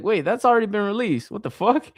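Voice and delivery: high, quick double take; baffled self-quote. Retold or imitated. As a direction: question it fast in sudden disbelief. Re-enacting confusion at old release.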